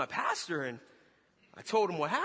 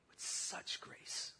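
whispered slowly; 9 dB quieter, much slower than usual. Acted. Slow whispered emphasis.

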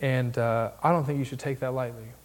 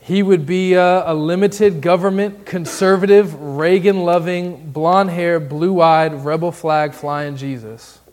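full-force preaching; 3 semitones higher, 8 dB louder, slower than usual. Acted. Pastor mocking the church's invented Jesus, full-force preaching. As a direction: hammer each word of the list.

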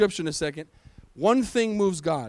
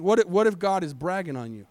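normal talking voice

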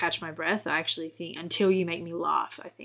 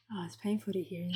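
normal talking voice

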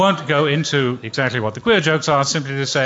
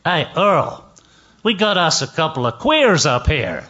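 lightly voiced good ol' boy calling to a buddy; a hint of Southern heckler; Texan heckler; 3 semitones higher than usual. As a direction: imitate a Dallas audience member calling out. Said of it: "comes a voice".

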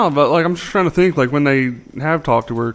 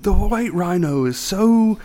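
higher, slow documentary narrator voice; nature show host; 6 semitones higher, slower than usual. Retold or imitated. Retelling a nature show blooper, slow narrator voice. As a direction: imitate a measured wildlife presenter. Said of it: "it was, like, a nature show".

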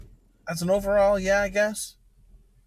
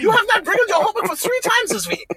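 much higher, laughing through a strict teacher voice; mock German teacher scolding; 12 semitones higher than usual. Mom mocking the teacher scolding Logan about homework. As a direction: scold the student while cracking up. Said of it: "She's like".

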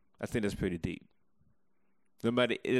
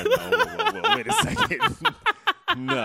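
surprised protest; 8 dB louder than usual. Real, not acted. Co-host protests loudly with laughter.

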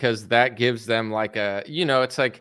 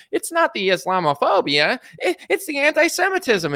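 much higher put-on mocking voice; DeSantis impression; 12 semitones higher than usual. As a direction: parody his preachy talking-point voice. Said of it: "he said it in that voice".

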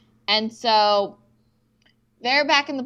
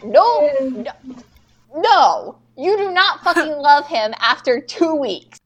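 loud, raised, emphatic denial; outraged refusal; 4 semitones higher, 6 dB louder, slower than usual. Acted. Fan scolding Camilla for saying love, outraged refusal. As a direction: shut it down, punching each no.

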